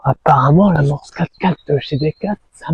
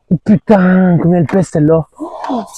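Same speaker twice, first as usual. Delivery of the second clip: amazed; 7 semitones higher than usual. Real, not acted. Amazed at heavy fish.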